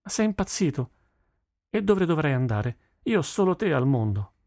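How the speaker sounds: high-pitched, heartfelt protest; incredulous daughter. A narrator's reading. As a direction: reassure your father, taken aback. Daughter protests her love for father. (figlia incredula e affettuosa)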